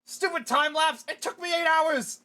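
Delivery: high loud furious outburst; angry rant. Acted. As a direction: rage at the delay. Villain rants angrily.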